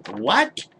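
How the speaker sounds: high loud disbelieving what; shocked what. Real, not acted. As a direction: gasp out what in outrage. Outraged disbelief.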